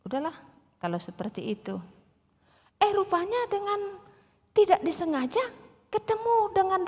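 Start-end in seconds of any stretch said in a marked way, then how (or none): 0.1-1.8 resigned sigh. Retold or imitated. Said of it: "setelah menangis".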